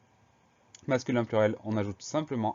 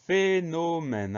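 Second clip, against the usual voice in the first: "phénomène" slow and higher, syllables held apart; 7 semitones higher, much slower than usual. Shown as a demonstration. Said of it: "première syllabe".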